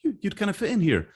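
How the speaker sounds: a hint of a coworker sizing him up; approving coworkers. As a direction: lift way up, amused and welcoming. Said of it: "and they're like".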